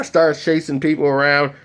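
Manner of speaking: ordinary talk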